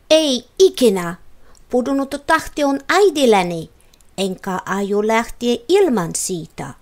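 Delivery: loud, defiant shout. A narrator's reading. Vivi shouting at the mirror, refusing to abandon the star. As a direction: cry it out, refusing to give up. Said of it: "huusi Vivi liivasti ja kiljuen".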